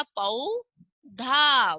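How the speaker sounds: very slow पौधा; slow demo of पौधा; drawn out. Shown as a demonstration. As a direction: stretch each sound of पौधा. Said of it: "उच्चारण एकदम सही सही होना चाहिए".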